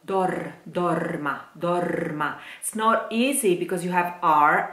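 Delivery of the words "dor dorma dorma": "dorma" with a clear rolled R before the M. Shown as a demonstration. Coach drilling the rolled R in "dorma". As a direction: roll the R in "dor, dorma". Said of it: "Careful with that R".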